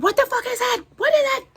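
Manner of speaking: high, loud shocked cry; retold panicked yelp of shock; startled shriek. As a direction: shriek it like you just jumped out of bed. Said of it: "I jumped the fuck and said".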